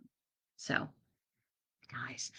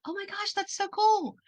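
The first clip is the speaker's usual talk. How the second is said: much higher and louder, thrilled gasp; excited gush; 13 semitones higher, 5 dB louder than usual. Retold or imitated. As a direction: gush like you just found something amazing. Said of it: "where I was like".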